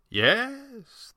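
high, drawn-out amused yes; gentle amusement, pitched high; amused old showman; gently amused. A narrator's reading. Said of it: "gently amused".

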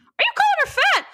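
shrieking high and loud, fast; outraged disbelief at the husband; incredulous outburst. Real, not acted. Reading aloud, she balks at the husband calling her fat, in incredulous outburst. Reader reacting to husband's remark.

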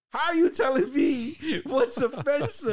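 high, loud disbelief breaking into a laugh; incredulous laughing. Retold or imitated. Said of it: "He's like".

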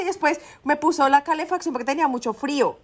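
ordinary talk

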